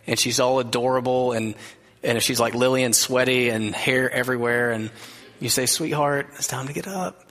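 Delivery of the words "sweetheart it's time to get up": put-on soft and tender, like waking a sleeping child; gentle wake-up voice. Shown as a demonstration. Pastor showing how a parent wakes a daughter, gentle and soft. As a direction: coax a little girl awake, barely above a murmur. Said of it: "It's that gentle".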